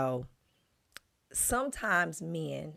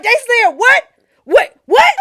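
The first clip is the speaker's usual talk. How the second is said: much higher, louder indignant what; outraged disbelief; 21 dB louder than usual. Retold or imitated. Wife imitating husband outraged at news. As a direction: imitate him hyped up in disbelief. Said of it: "And he be like".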